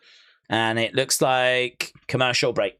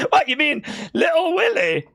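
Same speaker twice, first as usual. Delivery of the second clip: shouted mock Scottish reply, much higher; Scottish accent shout; 11 semitones higher than usual. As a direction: bellow it like a Glasgow local. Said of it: "my Scottish accent's not brilliant".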